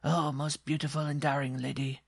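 high-pitched dwarf wooing ardently; shrill cry. Acted. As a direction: gush in a small, shrill voice. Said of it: "cried in a voice as shrill".

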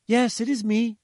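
high, loud mother's cry; triumphant shout. A narrator's reading. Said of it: "she shouted triumphantly".

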